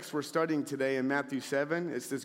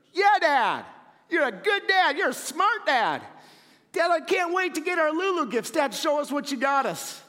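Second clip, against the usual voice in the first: gushing daughters; 11 semitones higher, 5 dB louder than usual. Retold or imitated. Daughters fawning over dad.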